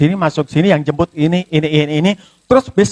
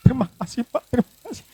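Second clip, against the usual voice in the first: softer, higher, choked-up thank you; tearful thanks; 6 semitones higher, 6 dB quieter, faster than usual. Retold or imitated. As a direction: thank him as if close to tears. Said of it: "Saya justru menangis".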